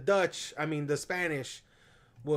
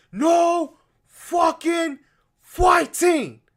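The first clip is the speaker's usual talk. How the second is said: high, slow, roared gangster order; furious shout; 13 semitones higher, 11 dB louder, much slower than usual. Retold or imitated. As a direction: bellow the ban at his men. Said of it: "He's like".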